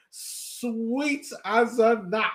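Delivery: slow, relishing delivery; savoured. Real, not acted. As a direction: savour every word slowly. Savouring the dunk replay.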